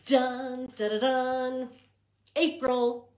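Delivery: sung drumroll reveal; fanfare. Acted. Librarian revealing the new month to kids, fanfare. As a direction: build up to the big reveal.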